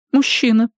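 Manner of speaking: высокий испуганный старческий голос; старушка в ужасе; взволнованная старушка. A narrator's reading. Jenny answers. (голос потрясённой старушки)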